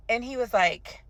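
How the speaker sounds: usual speaking voice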